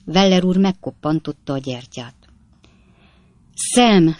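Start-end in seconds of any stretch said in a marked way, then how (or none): 3.5-4.2 higher, louder call with mild desperation; desperate effort. A narrator's reading. Pickwick calling Sam once more from bed, with desperate effort. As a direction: call his name as if forcing it out. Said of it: "szinte kétségbe esett erőfeszítéssel".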